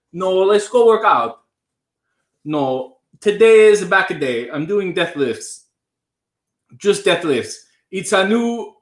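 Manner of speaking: fed-up Italian Canadian gym guy; Italian Canadian accent. Acted. Performer quitting the show in a huff, Italian Canadian accent. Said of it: "my parents have an Italian accent".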